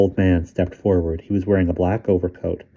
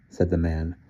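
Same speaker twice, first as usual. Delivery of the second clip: ordinary delivery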